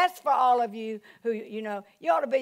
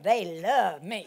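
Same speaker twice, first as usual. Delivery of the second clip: low, slow, savoring LOVE; drawn-out assurance; 5 semitones lower, much slower than usual. Retold or imitated. Preacher answering the devil's lie, slow low assurance. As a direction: stretch out "love" with settled confidence.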